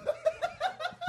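soft, very high-pitched laugh. Real, not acted. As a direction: crack up in a high squeal. Cracking up at the mix-up.

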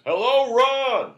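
loud, slow, high "Hello, Ron!" greeting; mock yell. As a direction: bellow the greeting, stretched out. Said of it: "Saget's joke with me was always".

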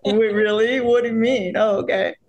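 high, breathless disbelief; stunned surprise. Retold or imitated. Actress on the phone with her producer, high with disbelief. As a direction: act like the news just landed. Retells hearing the news.

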